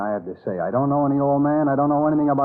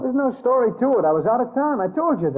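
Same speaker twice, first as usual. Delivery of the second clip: rising protest; 10 semitones higher than usual. Acted. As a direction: voice jumps high in heated denial. Suspect cornered in interrogation.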